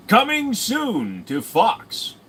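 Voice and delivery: mock trailer voice with a long rising coming. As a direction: stretch it out like a film trailer. Mock movie trailer announcement.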